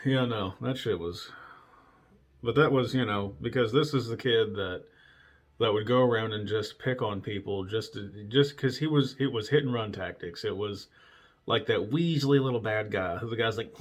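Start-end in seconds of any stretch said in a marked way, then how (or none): none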